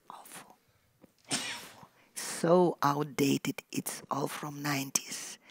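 real whisper of disgust; hushed, almost voiceless dismay. Real, not acted. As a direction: breathe it out, appalled. Disgust at outdated newspapers.

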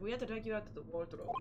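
quick mock Russian accent; imitated Russian accent. Streamer mimics a game character, Russian accent. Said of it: "talked like a Russian person".

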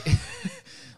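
high unvoiced giggle; quick airy laugh; breathy laugh. Real, not acted. Laughing while explaining.

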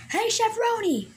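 high-pitched hail; calling out. Acted. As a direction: call over to the chef in a high voice. Calls out to another character.

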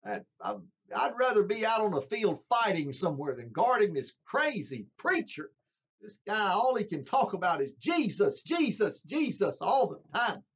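hammed-up fed-up guard griping; grumbling soldier. Soldier griping to his wife about the preacher, high and exasperated. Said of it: "perhaps saying to his wife".

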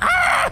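high-pitched Dragon Ball-style scream; mock battle scream, powering up; imitated power-up yell. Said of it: "literally yelling and powering up".

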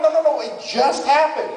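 high frantic protest; acted high-pitched alarm; panicked reply. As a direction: cut in urgently, voice way up. Friend replies in panic.

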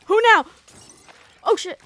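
high loud alarmed yelp; panicking gamer. Real, not acted. Panic, louder, higher.

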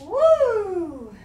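high, loud woo; long whoop sliding down; excited whoop. Real, not acted. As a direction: cheer out a big woo. Whoops while doing acrobatics.

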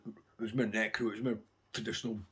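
ordinary delivery

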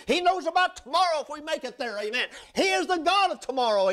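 high, ringing preacher's cry; preaching at full force. Acted. Preacher lifting his congregation to a high ringing cry. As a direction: lift it up and preach it out.

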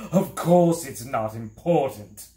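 loud, indignant outburst; angry snarl. A narrator's reading. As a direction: snap it out loudly at the boy. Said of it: "he snarled angrily".

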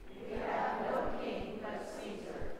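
soft, slow stage whisper; hushed reply, no voice; whispered priests' answer. A narrator's reading.

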